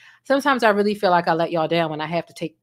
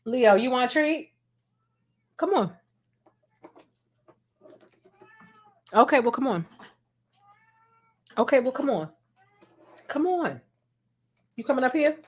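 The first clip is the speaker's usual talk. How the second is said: higher sing-song pet voice; coaxing cat; 6 semitones higher than usual. Real, not acted. Owner coaxing cat Leo with a treat, higher pet voice. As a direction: coax the cat over, higher and sweet.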